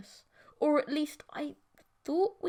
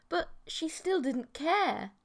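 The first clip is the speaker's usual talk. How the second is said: plain reading voice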